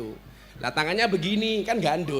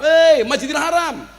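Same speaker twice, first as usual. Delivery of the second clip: mock loud question; 9 semitones higher, 8 dB louder than usual. Pilgrim asks directions.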